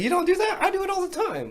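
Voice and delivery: high, loud imitation of an eager kid. Friend imitating the meat-slapping kid, high and loud. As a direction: play the kid who can't believe you don't.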